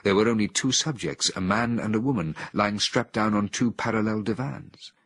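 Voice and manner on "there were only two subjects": ordinary delivery, nothing marked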